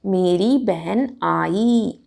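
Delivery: मेरी बहन आई very slow, long final ई; correct model sentence. Shown as a demonstration. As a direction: stretch each word of मेरी बहन आई. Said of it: "हम क्या कहेंगे". (मेरी बहन आई धीरे-धीरे)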